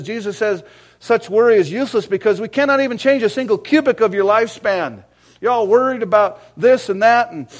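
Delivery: loud, high preaching voice; rising sermon fervor; fiery preaching. Real, not acted. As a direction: preach it hard to the congregation. Preaching at full force.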